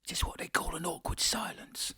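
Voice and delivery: soft, breathy whispered aside. Real, not acted. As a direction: whisper it under the silence. Whispered aside during silence.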